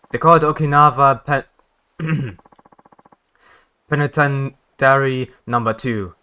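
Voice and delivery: talking through a mouthful; words muffled by food; mouth full. Real, not acted. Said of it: "there was something in my mouth".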